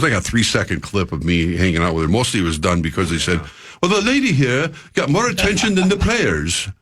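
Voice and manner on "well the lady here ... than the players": a bad, higher-pitched British accent; hammed-up British accent; awful British accent. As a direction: put on a clumsy posh British accent, pitched up. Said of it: "That was an awful British accent".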